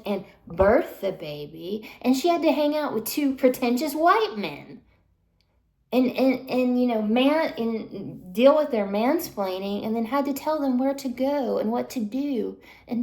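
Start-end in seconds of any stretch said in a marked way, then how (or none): 2.0-11.1 heated complaint about the men; angry rant. Real, not acted. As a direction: vent the outrage.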